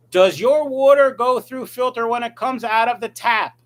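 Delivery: loud, high shouted question; reading a viewer's all-caps comment, yelling it. Shown as a demonstration. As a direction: bellow it like the chat typed it in capitals. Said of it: "so I had to yell it".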